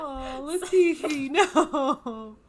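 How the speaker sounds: hammed-up crying wail over Latifi; mock wail. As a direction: fake a tearful lament, half laughing.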